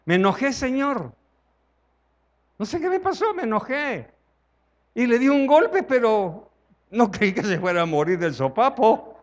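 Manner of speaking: high, pleading voice of a guilty Cain. Acted. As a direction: beg like a man caught out. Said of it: "ponerse en los pies de Caín". (Caín suplicando con voz aguda)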